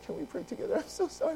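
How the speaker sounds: tearful plea climbing high; imitated crying. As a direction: break into mock sobs as you apologise. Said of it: "I start crying".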